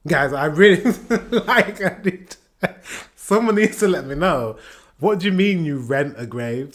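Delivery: laughing through the words, voice high; can't stop laughing at the idea; laughing disbelief. Real, not acted. Vlogger laughing in disbelief at rented graves.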